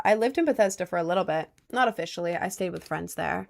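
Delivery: ordinary talk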